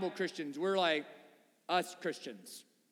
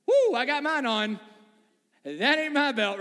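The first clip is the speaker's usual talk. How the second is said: loud, higher mock relief; imagined crowd relief; 5 semitones higher, 8 dB louder than usual. Everyone checking whose belt it is, loud mock relief. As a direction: play a crowd loudly relieved, with a whoop. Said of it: "I just imagine everybody in that moment like".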